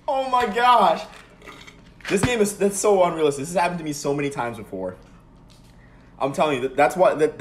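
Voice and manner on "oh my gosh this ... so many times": high, loud frustrated rant; indignant complaining outburst; exasperated. Real, not acted. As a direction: vent at the game, still stung. Still reeling from the score.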